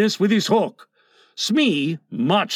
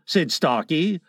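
ordinary delivery, nothing marked

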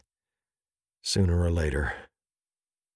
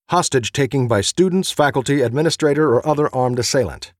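loud, higher-pitched safety protocol; loud official read; 7 semitones higher, 6 dB louder than usual. A narrator's reading. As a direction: read it like a briefing printed in all caps. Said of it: "each in the same bold, all-caps, italicized scream".

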